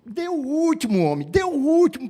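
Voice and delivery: mildly pleading for the last dip; urging. Acted. Servant urging Naaman to take the last dip, mild pleading.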